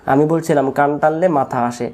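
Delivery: ordinary delivery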